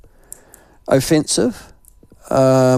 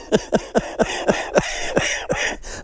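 breathy laugh; 7 semitones higher, 6 dB quieter than usual. Real, not acted. Laughs at her reply.

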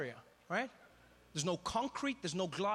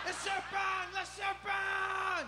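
high, hammed-up French cry of alarm; French tourist in mock panic, pitched way up; panicked Frenchman; 9 semitones higher, slower than usual. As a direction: yelp in a comic French accent, stretching the words. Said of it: "The French guy was my favorite".

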